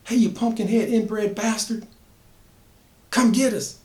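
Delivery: put-on girl taunting the killer to follow; taunting yell. A narrator's reading. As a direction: call him out, pitched high. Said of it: "Latrice yells".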